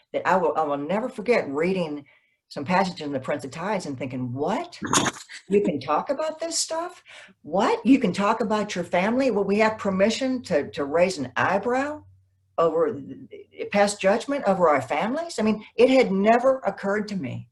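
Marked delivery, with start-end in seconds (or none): 4.3-15.4 high-pitched, incredulous questioning; amazed, voice jumping up on the questions; astonished disbelief. Retold or imitated. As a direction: replay the shock of a stunned reader. Retelling her astonished reaction while reading.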